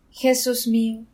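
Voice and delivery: usual speaking voice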